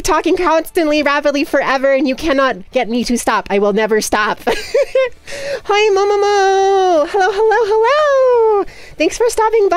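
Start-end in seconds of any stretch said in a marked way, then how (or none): 4.5-9.8 excited greeting. Real, not acted. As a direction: greet her with delight. Greeting a raiding streamer.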